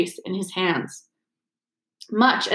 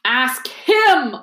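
shriek; 4 semitones higher, 4 dB louder than usual. Acted. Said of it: "shrieked Filch".

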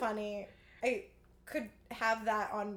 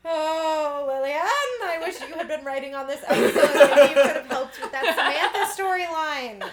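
laughing; 4 semitones higher, 11 dB louder than usual. Real, not acted. Laughing address to writer.

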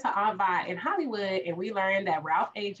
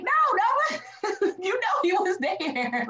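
laughing protest; 12 semitones higher, much slower than usual. Retold or imitated. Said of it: "and I'm like".

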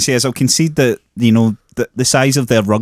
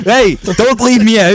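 high, slow, pleading "don't leave me out"; mock the friend feeling left out, high and drawn; whiny left-out voice; 7 semitones higher, slower than usual. Mocking a friend's pronunciation, high, slow and pleading. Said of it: "he said that in a way that went".

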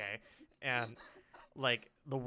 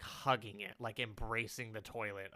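ordinary delivery

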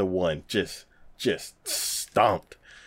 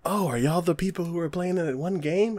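higher-pitched curious stranger; a touch of put-on voice, pitched up; lightly voiced businessman; 8 semitones higher, 4 dB quieter than usual. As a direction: imitate a man casually walking up to ask. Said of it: "this business suit walks up and he's like".

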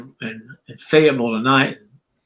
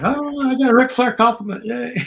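high mock-giddy cheer; hammed-up fanboy delight; mock excitement; 11 semitones higher than usual. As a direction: gush like a starstruck fan, tongue in cheek. Sarcastic mock cheer at compliment.

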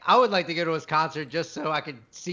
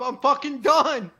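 retold storming off; 7 semitones higher, slower than usual. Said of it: "He fucking threw the cup".

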